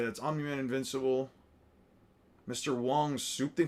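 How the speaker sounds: ordinary talk, nothing marked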